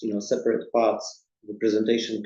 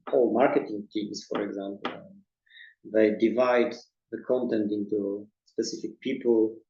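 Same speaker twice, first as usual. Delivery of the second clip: ordinary talk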